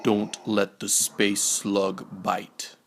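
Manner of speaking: ordinary delivery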